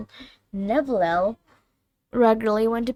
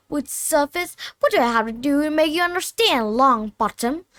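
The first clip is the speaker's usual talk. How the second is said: scolding teacher; 6 semitones higher than usual. Acted. Snape berating Neville.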